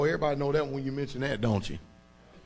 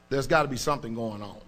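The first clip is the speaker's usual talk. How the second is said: ordinary delivery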